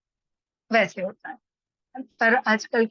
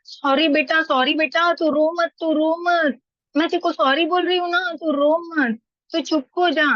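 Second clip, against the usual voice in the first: higher-pitched coddling mother soothing her crying son; pampering mother; 6 semitones higher than usual. Retold or imitated. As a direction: coo over the child like a doting mom. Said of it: "उसकी माँ है ना".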